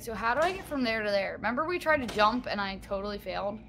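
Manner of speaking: normal talking voice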